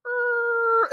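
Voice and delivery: a very high squeaky creak; chair squeak. Retold or imitated. Showing how his gaming chair creaked, a high squeak. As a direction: squeak like an old desk chair leaning back.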